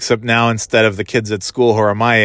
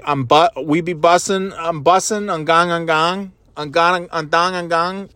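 mock teen slang; 6 semitones higher than usual. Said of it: "And I'm like".

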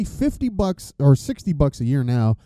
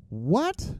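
mock confusion; 7 semitones higher than usual. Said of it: "Some of you are going".

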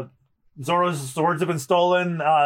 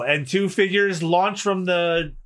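usual speaking voice

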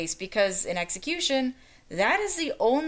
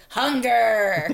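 loud villain call; 6 dB louder than usual. Pretend. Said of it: "the image of somebody going".

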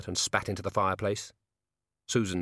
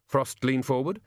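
plain reading voice

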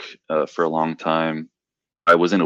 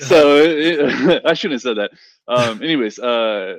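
nervous laugh; 6 semitones higher, 6 dB louder than usual. Real, not acted. After joking about killing people.

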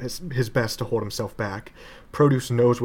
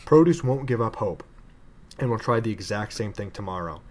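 ordinary delivery

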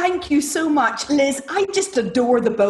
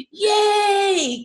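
a sudden high mock battle cry; playful high war whoop; warrior cheer; 9 semitones higher than usual.

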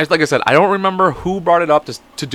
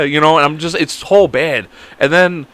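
usual speaking voice

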